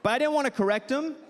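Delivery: high, laughing delivery. Real, not acted. Laughing at his own joke.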